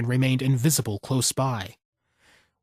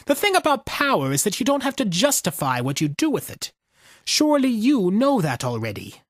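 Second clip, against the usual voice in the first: lightly voiced cold old soldier, a bit higher; hint of a detached, world-weary veteran; veteran's talking face; 7 semitones higher than usual.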